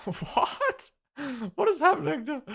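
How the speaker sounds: high, laughing "what is happening"; baffled, laugh through it up high; laughing disbelief. Real, not acted. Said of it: "reacting to chat message".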